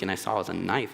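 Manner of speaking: ordinary talk, nothing marked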